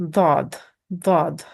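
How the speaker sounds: "dod" with a thick, heavy D; heavy D sound. Shown as a demonstration. Teacher shows learners the distinct letter, heavy D sound. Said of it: "It's kinda heavy D sound".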